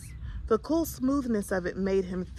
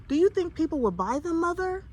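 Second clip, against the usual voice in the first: put-on higher, anxious boy's question; a hint of worry in a child's plea; worried; 7 semitones higher than usual. A narrator's reading. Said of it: "worried Herschel".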